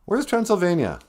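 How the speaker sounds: ordinary talk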